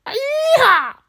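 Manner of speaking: very high American yeehaw; hammed-up American cowboy. Parodying the American way, cowboy American whoop. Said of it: "in the American way".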